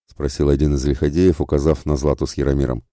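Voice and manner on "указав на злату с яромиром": ordinary delivery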